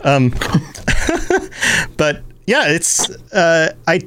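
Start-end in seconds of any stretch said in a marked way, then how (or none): 0.3-1.9 airy high-pitched laugh; high breathy laugh. Real, not acted. Laughs after a joke.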